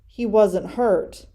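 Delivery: ordinary delivery, nothing marked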